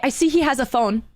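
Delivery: ordinary talk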